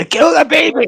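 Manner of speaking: high, loud mock scream. Acting out robbers in a movie, screaming. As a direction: yell it at a frantic pitch. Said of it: "We're literally screaming".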